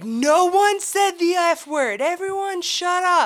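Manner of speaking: high, loud, slow commanding teacher; teacher covering for the driver, mock stern. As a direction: shut the whole bus down. Teacher quieting the kids.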